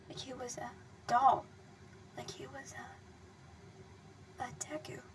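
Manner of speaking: barely audible, slow whisper; fading whisper. A narrator's reading. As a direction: let it fade into nothing. Narration fading into horror.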